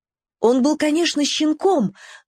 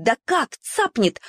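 ordinary delivery, nothing marked

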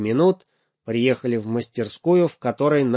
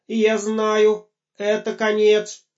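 shouting robot; 10 semitones higher, slower than usual. Acted. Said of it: "закричал Гоша".

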